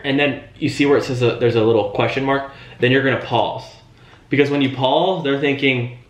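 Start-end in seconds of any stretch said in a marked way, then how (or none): none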